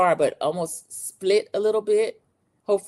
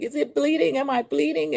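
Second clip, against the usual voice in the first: high, anxious questions; hurt woman fearing blood, voice much higher; panicked; 9 semitones higher than usual. Retold or imitated. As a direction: ask in worried alarm. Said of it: "And she's like".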